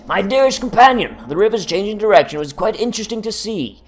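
lightly voiced letter writer.

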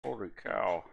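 words pushed through clenched teeth; talking through teeth. Real, not acted. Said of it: "Talking through my teeth right now".

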